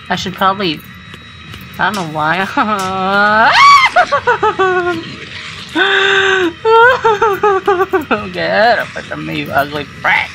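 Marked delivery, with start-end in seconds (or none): 2.5-8.3 panicked laugh-scream. Real, not acted. Gamer chased by the monster, panicked laugh-scream.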